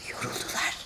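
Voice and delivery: breathy plotting whisper. Acted. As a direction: whisper it behind their backs. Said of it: "diye fısıldadı Antonio".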